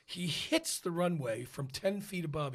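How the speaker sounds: ordinary talk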